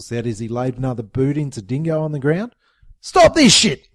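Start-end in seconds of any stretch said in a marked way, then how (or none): 3.0-3.9 high, loud commanding yell. A narrator's reading. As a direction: shout them down. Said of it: "Jimbo yelled".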